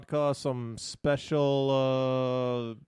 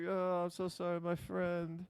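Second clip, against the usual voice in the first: apologetic Polish stranger; 5 semitones higher, 6 dB quieter, slower than usual. Retold or imitated. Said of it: "And then he goes".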